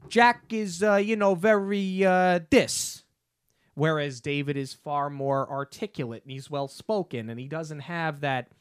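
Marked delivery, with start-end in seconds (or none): 0.1-3.0 higher-pitched mock New York street accent; imitating a thick New York newsie. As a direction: put on Jack's rough New York accent. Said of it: "in their accent".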